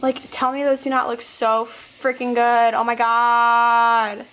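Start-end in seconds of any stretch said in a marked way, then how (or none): 2.7-4.3 slow, loud, stretched-out awe; drawn-out gushing. Real, not acted. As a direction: melt over the food.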